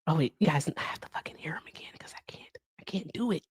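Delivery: very soft, half-whispered muttering; hushed aside. Real, not acted. Aside to viewers before doing an impression, hushed. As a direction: mutter it under your breath.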